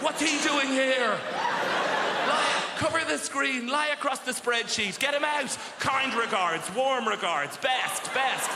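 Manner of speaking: hammed-up panic to hide the screen; mock panic. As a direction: office workers caught out, scrambling in a panic. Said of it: "They're like".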